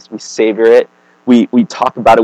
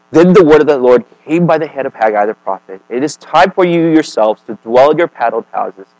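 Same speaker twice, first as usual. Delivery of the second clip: a lightly raised reading of the prophet's words, a bit louder; scripture reading; 4 semitones higher, 6 dB louder than usual. Acted. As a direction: read the verse out to the congregation. Reads scripture aloud.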